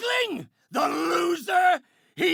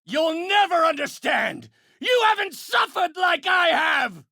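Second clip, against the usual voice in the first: bitter villain's shout; anguished rage. Acted. Villain telling hero he never suffered so, anguished rage. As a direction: yell from wounded fury.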